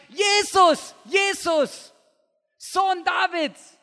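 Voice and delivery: loud, high desperate cry; shouting. Acted. Blind man cries out to Jesus from the roadside, loud and high. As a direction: call out over the crowd, begging. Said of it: "er steht auf und schreit".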